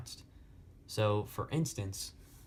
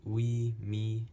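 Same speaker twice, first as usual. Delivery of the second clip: "ui, mi" as two equally stressed beats; spondee scansion; 3 dB louder than usual. Shown as a demonstration. Teacher marking a metrical foot, even spondee stress on "ui, mi". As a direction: hold both syllables of "ui, mi" evenly, both stressed. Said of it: "second one is a spondy".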